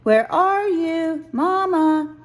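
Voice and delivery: high, loud, slow call. A narrator's reading. Lost cub calls to Mama through the woods, high and loud.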